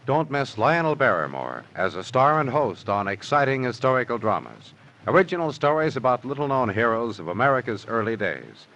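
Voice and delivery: ordinary talk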